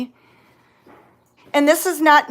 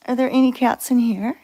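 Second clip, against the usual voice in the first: normal talking voice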